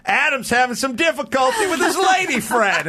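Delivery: high, loud mock announcement; announcing to class. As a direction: broadcast his heartbreak to everyone. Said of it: "He goes".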